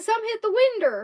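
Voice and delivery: "winder" with an -er ending; high, loud quote of the boy's "winder"; retold country pronunciation. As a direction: say window as "winder". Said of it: "He said".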